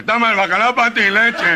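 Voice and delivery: very high, loud cry with laughter; exasperated nasal squeal. Acted. Gangoso giving up the stolen cod to the sergeant, squealing. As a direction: throw out the cod line in a shrill outburst.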